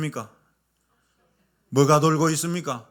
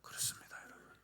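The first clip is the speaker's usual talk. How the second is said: whisper; 24 dB quieter, faster than usual. Real, not acted. Sermon whisper.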